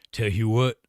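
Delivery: a lightly put-on character voice; put-on catchphrase voice. Shown as a demonstration. Chat told him to say it that way, a light put-on voice. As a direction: toss off the catchphrase in a borrowed voice. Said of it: "You gotta say it like that".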